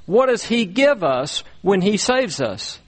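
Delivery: ordinary talk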